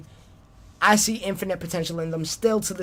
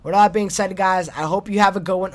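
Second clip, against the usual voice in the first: louder, punchy catchphrase; loud sign-off; 3 semitones higher, 7 dB louder than usual. Shown as a demonstration. Host signing off to listeners, louder. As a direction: belt out the closing line. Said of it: "I always gotta end it with that".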